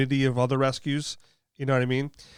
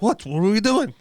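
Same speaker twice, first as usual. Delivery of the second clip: higher, louder imitation of a startled dog; mock-startled pet voice, pitched up; startled dog's voice; 10 semitones higher, 6 dB louder than usual. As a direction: play a dog suddenly roused by a touch. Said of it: "then she'd be like".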